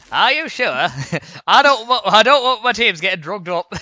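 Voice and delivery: loud, high mock Yorkshire; Yorkshire accent. Joking about weed in plant pots, hammed-up Yorkshire accent. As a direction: put on a broad, exclaiming Yorkshire voice. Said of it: "doing a Yorkshire accent".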